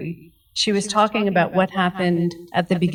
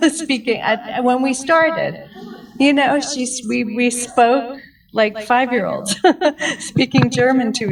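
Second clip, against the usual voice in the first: laughs through the words, pitch up and louder; giggling at the memory, raised and bright; laughing while telling; 4 semitones higher, 4 dB louder than usual. Real, not acted. Telling friends about practicing German with her mother, laughing. Recalling speaking like five-year-olds.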